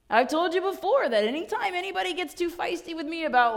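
usual speaking voice

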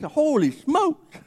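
slow, awed gasp of a phrase; drawn-out wonder at the ring; mock astonished; amazed. Amazed at huge diamond.

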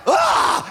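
high, loud playful roar; mock roar. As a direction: roar to scare the bully kid. Scaring the kid in pit.

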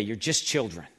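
low, soft and dismissive. Shown as a demonstration. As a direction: brush them off as mere kids. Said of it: "not saying this in a dismissive way".

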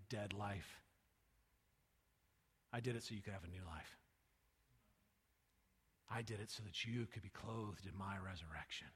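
soft, low, gentle reassurance; tender. Acted. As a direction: fade down to a hush, tender. Jesus speaking tenderly.

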